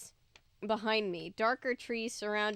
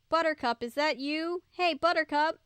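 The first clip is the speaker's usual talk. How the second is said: higher, louder call for the dog; 6 semitones higher, 4 dB louder than usual. A narrator's reading. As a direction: call the dog's name hopefully. Calling the lost dog.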